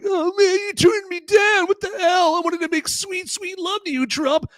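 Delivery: very high, whiny falsetto lover; mock De Niro. Streamer voices De Niro begging Trump in a high whine. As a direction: plead like a jilted lover, way up high. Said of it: "De Niro was like".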